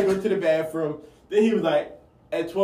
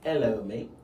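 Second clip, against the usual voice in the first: lower, soft mock-English greeting; English accent; 6 semitones lower, 7 dB quieter than usual. Shown as a demonstration. Friend taking a turn at the accent, low English accent. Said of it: "say it in your English accent".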